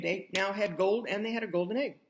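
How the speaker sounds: plain reading voice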